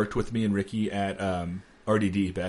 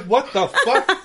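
what the fuck, half laughing, higher; laughing disbelief; 12 semitones higher, 5 dB louder, much slower than usual. Retold or imitated. Retelling coworker's heroin joke to friend, laughing disbelief. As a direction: blurt it out through a laugh, stunned.

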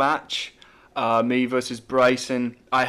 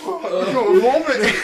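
high squeaky laugh-talk; words breaking into laughter; laughing hysterically; 11 semitones higher, 5 dB louder than usual. Real, not acted.

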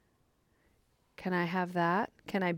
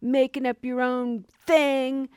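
loud, higher-pitched sneering tempter; cynical, gnarly Mara; 6 semitones higher, 8 dB louder than usual. Acted. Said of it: "that just cynical, gnarly voice".

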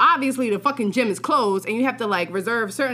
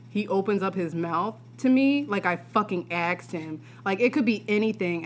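ordinary talk

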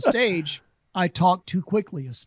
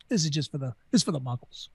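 winking aside; 3 semitones lower, 6 dB quieter, faster than usual. Shown as a demonstration. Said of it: "occasionally I wink at them".

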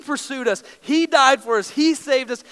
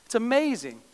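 much softer, savouring admiration; hushed awe; 2 semitones lower, 8 dB quieter than usual. Retold or imitated. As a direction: gaze at it in quiet wonder. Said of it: "And I was like".

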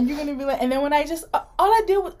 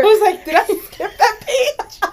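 laughing; 8 semitones higher, 6 dB louder than usual. Real, not acted. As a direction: ask it while cracking up. Joking while both laugh.

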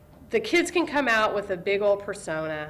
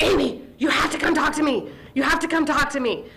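urgent pleading; 7 semitones higher, 4 dB louder than usual. Retold or imitated. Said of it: "she was like".

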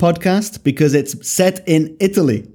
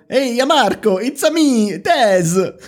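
high, exaggerated cartoon Italian accent; mock Italian accent; 8 semitones higher, slower than usual. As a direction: greet a pal in hammed-up Italian English. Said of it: "exactly how Des and I speak English".

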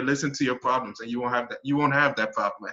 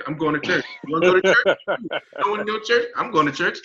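mock breezy announcement; 8 semitones higher, 6 dB louder than usual. Announcing plans to friend.

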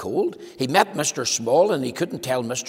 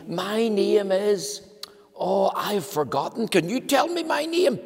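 slow, much higher, puzzled voice; muddled Mister Forgetful; 7 semitones higher than usual. Acted. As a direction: lose your name halfway, then squeak the question. Mister Forgetful forgetting his name.

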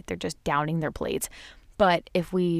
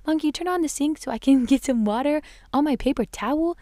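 put-on high childlike voice, a bit louder; little son; 8 semitones higher, 4 dB louder than usual. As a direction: ask sweetly like a small boy. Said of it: "he'll say".

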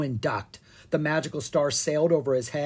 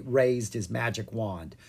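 plain reading voice

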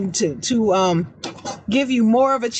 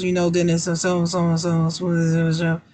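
mumbling; 5 semitones lower than usual. Shown as a demonstration. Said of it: "talking like this".

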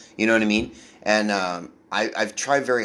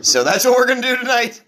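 much higher and louder, a mock club booker announcing the plan; hammed-up booker voice; 13 semitones higher, 7 dB louder than usual. Comedian mocks a booker's lineup plan, in a high hammed-up voice. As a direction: play the booker breezily wrecking the lineup, way up high.

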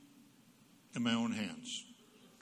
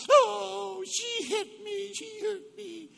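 put-on much higher, loud, slow whimpering child voice; whiny tattling kid; 6 dB louder, much slower than usual. Child complaining to parent about sister, whiny. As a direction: play the wounded victim to the parent.

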